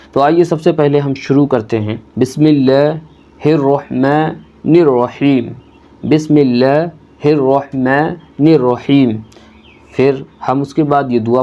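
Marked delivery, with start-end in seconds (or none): 2.2-9.1 slow, drawn-out Quranic recitation. Acted. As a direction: intone each word slowly and reverently. Teacher recites opening formula.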